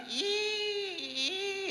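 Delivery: high thin creak of a door by day; a light, high door squeak. Shown as a demonstration. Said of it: "la puerta que de día suena así".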